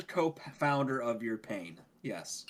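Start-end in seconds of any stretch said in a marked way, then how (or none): none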